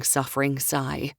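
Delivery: usual speaking voice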